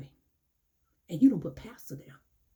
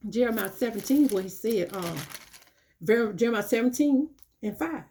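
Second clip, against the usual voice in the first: normal talking voice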